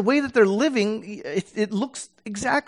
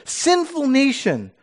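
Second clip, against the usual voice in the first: high, slow, pleading cry; exasperated plea; 7 semitones higher, much slower than usual. Shown as a demonstration. God pleading with his people, exasperated and high. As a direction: shake them awake with the words. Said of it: "grab you and shake you and say".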